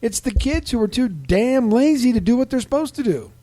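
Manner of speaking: mock-indignant old man's voice; indignant old fireman. His, his words.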